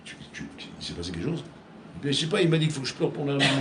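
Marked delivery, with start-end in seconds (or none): 0.0-1.4 chuchoté. Retold or imitated. L'autre demande inquiet, tout bas.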